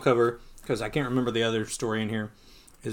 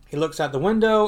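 ordinary delivery

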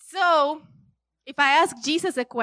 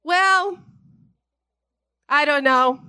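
ordinary talk, nothing marked

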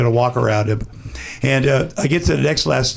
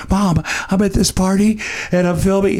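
mock excited friend; 5 semitones higher than usual. Friend at the party, imitated with a naive higher voice. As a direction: play him as an oblivious kid checking in. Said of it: "And he's like".

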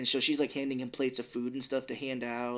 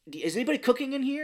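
high, fast, louder mock urgency; frantic food hunter; 12 semitones higher, 5 dB louder, faster than usual. Host acting the man who sniffs out food, frantic. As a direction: blurt it like someone hot on a scent.

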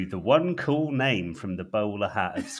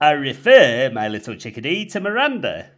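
loud, higher North Yorkshire retort; North Yorkshire accent; 6 semitones higher, 14 dB louder than usual. Acted. Jizz mopper retorting to the operative, North Yorkshire accent. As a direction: snap back in broad Yorkshire. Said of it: "in his gruff North Yorkshire tone".

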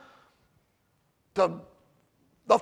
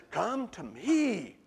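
slow, low and much softer, like Jesus calling; gentle invitation; 4 semitones lower, 8 dB quieter, much slower than usual. Acted. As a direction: draw them in quietly and slowly. Jesus quoted, soft and slow.

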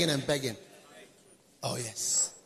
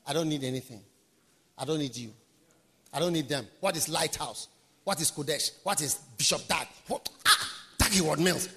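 proud person; 7 semitones lower than usual. Retold or imitated. Preacher mimicking a proud man who needs no one, low and dismissive. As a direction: mutter it with haughty disdain. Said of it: "this is how they talk".

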